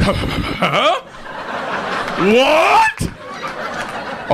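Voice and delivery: drawn-out rising roar of disbelief; imitated enraged boss. Boss just cursed at by his employee, mock outrage. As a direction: climb high into a monstrous, outraged question.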